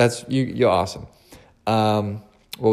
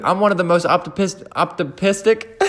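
laughing; 7 semitones higher than usual. Real, not acted. Laughs while stumbling on word.

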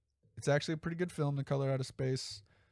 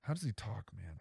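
usual speaking voice

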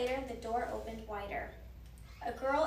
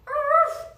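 high, loud little dog yap; sharp imitated bark; 16 dB louder than usual. A narrator's reading. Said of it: "barked Teddy".

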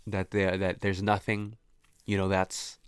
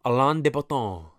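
Alain de Botton with a French accent; crisp French-style name; French pronunciation; 3 semitones higher, 3 dB louder than usual. Shown as a demonstration. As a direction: say the name the French way, nasal final vowel. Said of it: "his name sounds so French to me".

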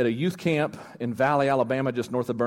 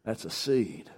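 low, soft Southern drawl; hushed, deep Southern reply; put-on Southern accent; 5 semitones lower, 8 dB quieter, slower than usual. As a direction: sound spiritual in a mock Southern accent. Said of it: "you have to talk in a southern accent".

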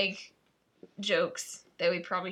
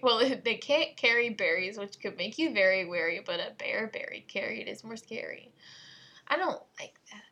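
usual speaking voice

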